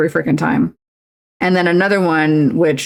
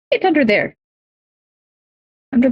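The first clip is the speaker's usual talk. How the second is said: pet voice; 7 semitones higher, faster than usual. Real, not acted. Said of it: "repeats higher to pet".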